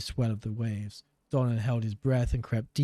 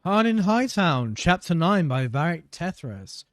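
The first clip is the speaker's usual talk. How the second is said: reading a title aloud, raised and bright; story narration; 6 semitones higher, 4 dB louder than usual. Acted. Gamer reading a novel title to viewers, storyteller voice. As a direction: announce the chapter like a storyteller.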